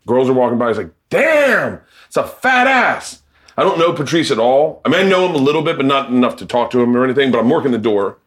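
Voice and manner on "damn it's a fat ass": a high, slow, lightly voiced catcall; drawn-out leering street holler, lightly done. Patrice catcalling women on the street, high and slow. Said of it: "Patrice is catcalling women".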